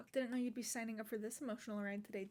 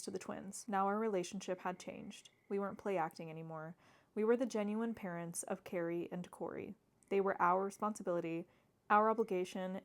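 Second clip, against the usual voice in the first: steady book-reading voice; reading aloud; 5 dB louder than usual. Acted. Vlogger reading the novel's text to viewers, steady reading voice. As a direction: read the passage out like a narrator.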